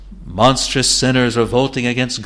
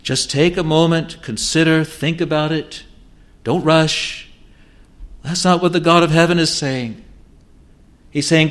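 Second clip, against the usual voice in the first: higher, building preacher's appeal; fervent preaching; 5 semitones higher than usual. Acted. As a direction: press the point with rising urgency.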